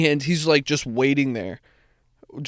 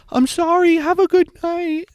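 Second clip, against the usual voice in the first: much higher, bright and chirpy imitation; sweet girl voice; 13 semitones higher than usual. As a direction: mimic her overly sweet apology. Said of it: "the girl from the back goes".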